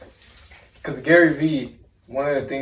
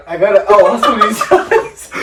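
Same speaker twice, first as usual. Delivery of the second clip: loud laugh-through imitation; 7 semitones higher, 11 dB louder than usual. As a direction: gasp it out laughing. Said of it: "he's always like".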